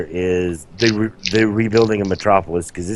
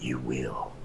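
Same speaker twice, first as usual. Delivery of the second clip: low, very soft movie threat; imitated trailer line, deep and hushed; Batman; 8 semitones lower, 14 dB quieter than usual. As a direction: drop right down for a quiet menacing line. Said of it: "and then you hear".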